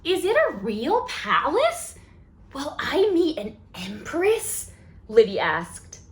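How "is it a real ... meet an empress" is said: put-on eager wondering child voice; excited child. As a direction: ask it like a thrilled little girl. Child character asks excitedly.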